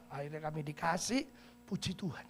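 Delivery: ordinary talk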